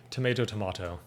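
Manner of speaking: TOMATO as "to-MAY-to", then "to-MAH-to"; American, then British TOMATO; tomato both ways. Shown as a demonstration. Contrasting the two pronunciations.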